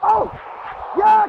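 loud high celebratory yell; excited cheer. Real, not acted. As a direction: cheer the play as it happens. Cheering a big play.

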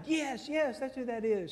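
high, eager yes yes; imitating a thrilled guide; excited tour guide. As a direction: burst out with delight at finally being asked. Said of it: "She got all excited".

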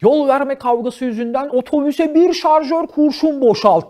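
high, loud, drawn-out headline voice; news headline read. Acted. Comedian reading out a shocking traffic news headline, mock newsreader delivery. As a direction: belt it out slowly like a sensational headline. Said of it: "bu nasıl haber başlığı".

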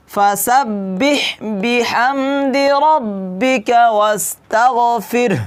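slow drawn-out recitation; Quran recitation. Shown as a demonstration. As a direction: recite slowly, stretching each word. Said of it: "এটা ভালো করে খেয়াল করবেন". (ধীরে তিলাওয়াত)

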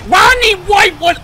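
excited call. Real, not acted. Big play call.

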